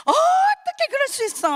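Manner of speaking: squeaky mock indignation; indignant glare. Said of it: "막 째려보면서".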